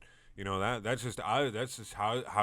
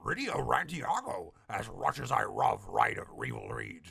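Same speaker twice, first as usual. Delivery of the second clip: slither the greeting; lightly voiced dark dog villain; evil Odie.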